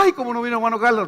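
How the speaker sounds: fast, whiny excuse; hammed-up timid believer; mock whiny complaint. Timid believer wishing for brother Carlos: fast mock whine.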